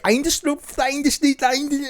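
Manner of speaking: high-pitched, garbled mock speech; bumbling language learner. Comic mocking himself trying to speak German, high babble. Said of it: "an impersonation of me trying to speak German".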